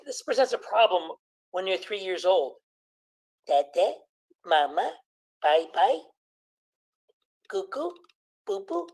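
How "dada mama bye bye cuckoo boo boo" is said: put-on toddler babble. Voicing a three-year-old.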